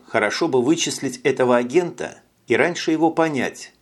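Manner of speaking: plain reading voice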